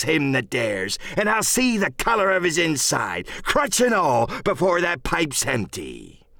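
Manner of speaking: high-pitched, blustering sea-dog; swaggering salty threat; pirate captain Silver, challenging. Acted. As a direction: dare the crew to fight you.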